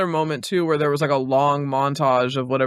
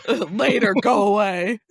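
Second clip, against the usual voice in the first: mock dismissive shout; 6 semitones higher, slower than usual.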